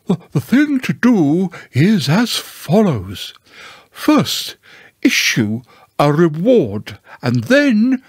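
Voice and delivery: high, swooping lecturing owl; Owl, pompous. Acted. Owl explains the procedure to Pooh, pompously. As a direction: lay out the procedure importantly.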